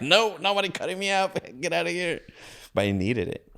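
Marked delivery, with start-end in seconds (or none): 0.0-2.2 high-pitched panicked protest through laughter; mock panicked refusal. Refusing surgery to the doctors, a high laughing mock panic. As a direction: squeal it out like a scared kid bolting.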